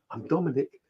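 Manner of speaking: soft, hushed ghost voice; lightly voiced ghost introducing itself. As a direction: breathe it like a friendly ghost. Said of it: "It's going".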